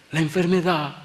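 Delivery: high, hinting woman's voice; alarmed mother. Retold or imitated. Mother tells the pastor about the illness, anxious. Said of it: "a la mamá del muchacho".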